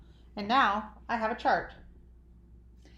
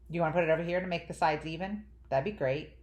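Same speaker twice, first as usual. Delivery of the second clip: ordinary delivery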